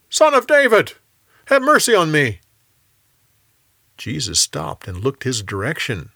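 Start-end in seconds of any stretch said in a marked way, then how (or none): 0.1-2.4 much higher, loud desperate cry; yelling even louder. A narrator's reading. Hushed by the crowd, the beggar cries out louder, much higher. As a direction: shout it out above everyone, louder still. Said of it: "he yelled even louder".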